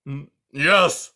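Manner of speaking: put-on loud old porcupine bellow. A narrator's reading. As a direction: boom it out at the mice. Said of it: "bellowed Aerith".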